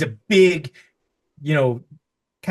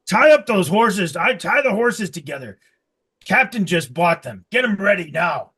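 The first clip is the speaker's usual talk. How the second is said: gruff orders; 6 semitones higher, 6 dB louder than usual. Acted. Orc barking orders at girl.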